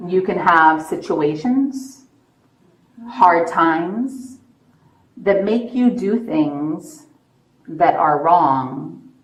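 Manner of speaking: slow, measured coaxing; slow and deliberate. Real, not acted. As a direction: spell it out slowly and gently. Officer coaxing a confession.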